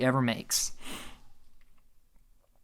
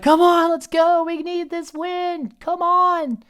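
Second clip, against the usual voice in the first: screaming; slower than usual. Retold or imitated. Chris yelling at his fiance to win immunity, high frantic shout. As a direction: pump her up, come on let's go. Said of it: "So he's screaming".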